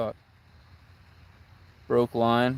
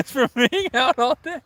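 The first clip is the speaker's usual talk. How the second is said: laughing; 8 semitones higher, 10 dB louder than usual. Real, not acted. Reading chat comment, laughing.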